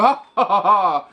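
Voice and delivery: hammed-up spelt-out "ha ha" laugh; mock movie laugh. Dubbed kung fu fighter before a fight, mock laugh.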